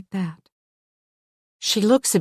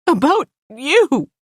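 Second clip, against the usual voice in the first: bursts out higher, louder and slow; a tearful woman blurting the answer to Louise; blurted out; 10 semitones higher, 6 dB louder, slower than usual. Acted. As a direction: blurt it out as if it escapes her. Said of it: "she blurts".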